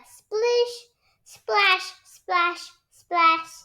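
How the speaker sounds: high, playful little-brother voice; George splashing. A narrator's reading. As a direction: splash the words like a toddler. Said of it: "says George".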